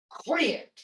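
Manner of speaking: snapped Quit like a threat; harsh threat. Shown as a demonstration. Teacher showing the harsh English way of commanding. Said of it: "but it's not like".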